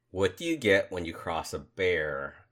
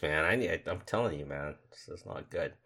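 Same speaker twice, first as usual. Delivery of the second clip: usual speaking voice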